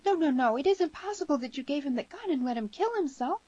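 put-on high girl's protest, loud at the start; frantic high-pitched denial; horrified crying out. A narrator's reading. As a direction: burst out high in disbelief. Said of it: "cried the girl".

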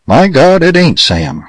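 sudden high cry that drops back; yell. A narrator's reading. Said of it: "yelled Sawtelle".

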